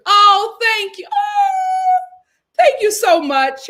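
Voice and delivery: high, loud squeal of gratitude; gushing thanks. Real, not acted. Host thanks a viewer for kind words, gushing. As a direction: gush it out with a drawn-out squealed "oh".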